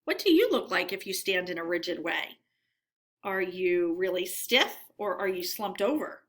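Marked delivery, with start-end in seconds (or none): none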